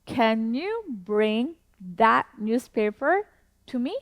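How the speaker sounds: very slow, each word spaced out; slow teacher demo with a rising question end; slow model sentence. Shown as a demonstration. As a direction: model it slowly for learners to repeat. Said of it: "one more time".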